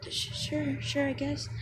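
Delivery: high, soft, stammering reluctance; hesitant girl. Retold or imitated. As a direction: agree nervously, stumbling at the start. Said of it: "And she's like".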